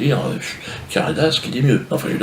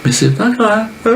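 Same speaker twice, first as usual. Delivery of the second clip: much higher mock cheer; mock fairy voice; 12 semitones higher than usual. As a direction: brush it off in a high fake-kind voice. Fairy voice in story.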